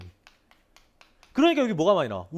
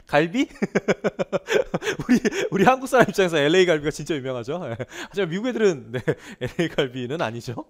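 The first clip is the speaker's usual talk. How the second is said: chuckling through the words; laughing at own joke; 4 dB louder than usual. Real, not acted. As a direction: laugh as you deliver the punchline. Joke about LA galbi. (자기 농담에 웃으며 말하기)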